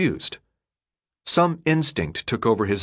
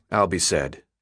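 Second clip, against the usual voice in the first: usual speaking voice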